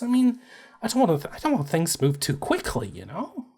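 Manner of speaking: a nervous dork trailing into a whisper; nervous dork prince. Acted. Pratt dithering to Susie about becoming queen, high and soft.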